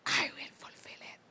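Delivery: whispered promise, breath only. Acted. Preacher quoting the Lord's promise in a whisper. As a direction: hush it like a sacred secret. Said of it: "sabi ni Lord".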